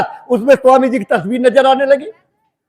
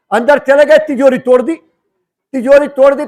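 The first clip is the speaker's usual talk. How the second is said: usual speaking voice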